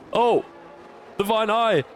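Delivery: high-pitched thrilled race call; excited commentary. Real, not acted. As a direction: shoot up high as the move happens. Live call of an overtake.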